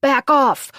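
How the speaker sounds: loud high bark. A narrator's reading. Monk barks at the crowd before grabbing Gemma, loud. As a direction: snap it out hard and loud.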